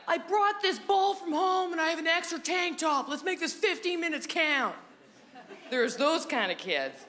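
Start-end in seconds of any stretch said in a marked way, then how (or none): 0.1-4.8 high, eager playground go-getter; mock a kid raring to play; keen sporty kid. Comic playing an over-prepared kid at recess, high eager voice. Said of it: "the kind of kids who were like".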